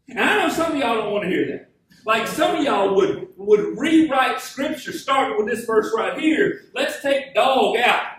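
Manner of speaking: full-force preaching, loud and driving. Acted. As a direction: preach it out to the congregation, pressing every line. Preacher addresses the congregation.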